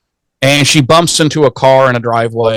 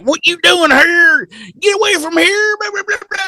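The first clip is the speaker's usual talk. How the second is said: hammed-up high-pitched yelling; yelling weird old man. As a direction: screech like a crazy old hillbilly, tailing into gibberish. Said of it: "he's yelling all this stuff".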